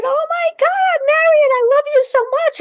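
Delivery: high, loud, tearful outburst reliving the moment; tearful cry. Retold or imitated. To a bird she believes is Marion, tearful loud cry. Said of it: "And I'm crying".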